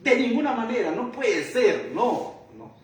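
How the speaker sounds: modern-day retort in Paul's name; emphatic refusal. Retold or imitated. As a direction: spell it out, it cannot be. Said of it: "Yo en lenguaje actual diría".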